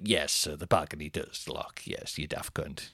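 low, quiet, placating staff voice; deep-voiced polite brush-off, dying away at the end; unbothered hotel staff. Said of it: "They were like".

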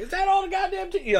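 high, loud mock freak-out. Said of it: "just, like, freaking out".

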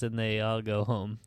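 ordinary delivery

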